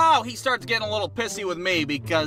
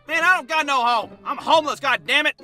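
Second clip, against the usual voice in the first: put-on a touch higher, grumpy old drifter; old homeless man; 5 semitones higher than usual. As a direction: lightly voice a cranky old bum.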